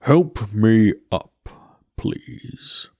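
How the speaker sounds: slow, grave skeleton voice; Death's voice. Acted. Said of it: "said the skull".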